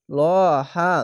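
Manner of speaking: ল held with a thick o before হা; long heavy vowel. Shown as a demonstration. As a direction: draw out a rounded ল, then হা. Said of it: "মোটা করে পড়তে হবে". (ল মোটা করে এক আলিফ টেনে)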